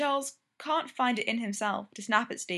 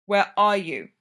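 loud, fierce demand; a loud, insistent, angry question; 8 dB louder, slower than usual. A narrator's reading. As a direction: demand an answer hard and loud. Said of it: "Eric demands fiercely".

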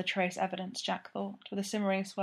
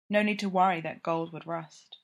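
plain reading voice

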